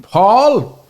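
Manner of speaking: high, loud shout of a name; calling out sharply. Retold or imitated. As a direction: yell the name like a scolding mother. Mother calling out son's name.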